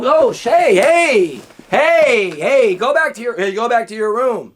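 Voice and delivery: high, loud shooing; flustered parent shout. Acted. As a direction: call out to send a kid away. Acts parent shooing child out.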